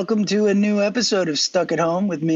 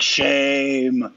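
shame; 3 semitones lower than usual. Acted. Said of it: "woman from Game of Thrones".

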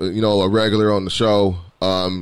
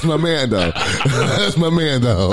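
laughing; 5 semitones higher, slower than usual. Real, not acted. Laughing at friend's awful remark.

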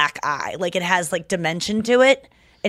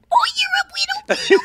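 put-on very high, squeaky baby talk; hammed-up adoring squeal; gushing over birds. Shown as a demonstration. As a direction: coo ecstatically at a tiny bird. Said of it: "I do it with birds".

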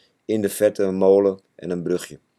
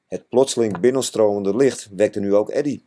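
usual speaking voice

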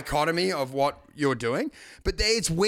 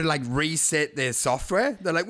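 normal talking voice

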